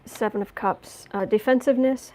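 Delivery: ordinary talk, nothing marked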